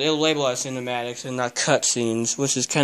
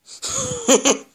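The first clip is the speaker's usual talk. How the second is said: short laugh. Real, not acted. Laughs at the cat.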